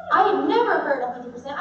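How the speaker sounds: ordinary talk, nothing marked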